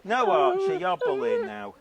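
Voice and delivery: scolding the dog. Real, not acted.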